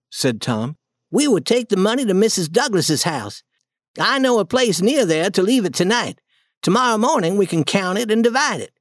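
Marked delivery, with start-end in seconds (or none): 1.1-8.7 put-on higher boy's voice, eager planning; boy Tom, eager and practical; Tom voiced, planning. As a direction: Tom laying out the plan to Huck. Tom plans hiding the money.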